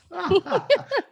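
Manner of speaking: high burst of laughter; sudden high laugh of delight; real laugh. Real, not acted. Guest laughs at host's remark.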